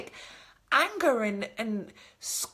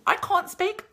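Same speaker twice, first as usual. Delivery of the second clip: usual speaking voice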